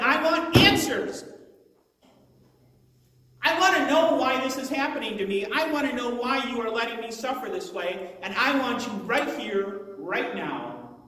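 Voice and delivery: loud, high outburst easing toward the end; acted indignant shouting that gradually settles; defiant demand. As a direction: challenge God at full voice, demanding an answer. Job standing up to God, demanding.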